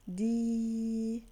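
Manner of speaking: "دي" held as long, steady dii; stretched-out syllable "دي"; long vowel. Shown as a demonstration. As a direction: sustain the vowel of "دي" evenly. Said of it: "ما هو الصوت الطويل".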